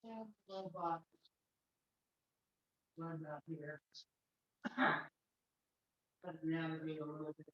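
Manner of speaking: distant, off-mic voice; speaking from across the room; from another room. Real, not acted. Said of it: "You're a bit far from your microphone".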